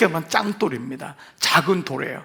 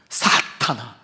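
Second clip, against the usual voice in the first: stern rebuke; 6 semitones lower, much slower than usual. Retold or imitated. Jesus rebukes Peter, deep voice.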